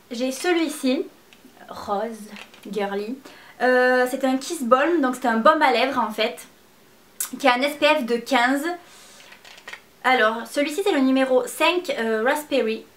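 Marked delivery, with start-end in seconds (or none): none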